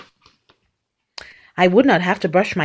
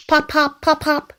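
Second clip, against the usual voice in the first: higher-pitched, short pops; popping cork; 6 semitones higher than usual. A narrator's reading. Reader shows how a cork sounds, high pops. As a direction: pop each word like a cork. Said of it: "He can sound like a cork".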